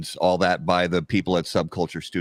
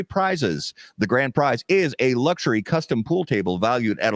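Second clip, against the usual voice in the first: ad read; 4 semitones higher than usual. Acted. Reads sponsor ad.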